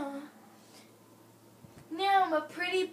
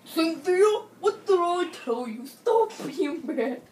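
loud, stern telling-off, slowing on stop being bad; angry scolding; 8 dB louder than usual. Father scolds Cynthia for being bad, loud angry scold. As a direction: scold the child hard.

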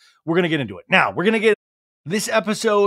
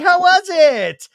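high, loud, slow polite question; forced interest; 14 semitones higher, 9 dB louder, slower than usual. Retold or imitated. Ann asking Sandoval about the trip, high forced cheer. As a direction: feign interest. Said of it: "she's like".

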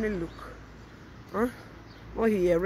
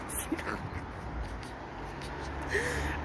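long, soft breathy laugh; drawn-out hushed cackle; gloating laugh; 9 semitones lower, 7 dB quieter than usual. Real, not acted. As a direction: laugh it out quietly at their downfall. Gloating over rivals' disgrace.

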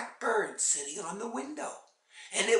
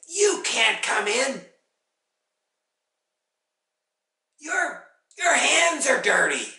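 scolding witch, raised voice; loud refusal; 8 semitones higher, 9 dB louder than usual. A narrator's reading. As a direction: turn the visitor away through a door. Witch refuses through the door.

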